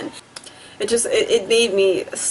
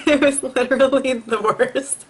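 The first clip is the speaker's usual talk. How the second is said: laughing; 6 semitones higher than usual. Real, not acted. Laughing mid-sentence.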